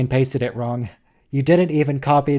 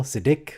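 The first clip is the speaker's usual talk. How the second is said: "Siddiq" stressed as si-DIQ; stress on second syllable. Shown as a demonstration. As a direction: demonstrate "Siddiq" with weight on the last syllable. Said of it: "emphasis on the second syllable".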